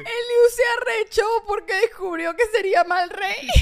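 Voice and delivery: high, loud laughing cry; laughing shout. Real, not acted. Mocking Liu for discovering he'd be a bad king, laughing shout. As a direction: crack up while teasing him.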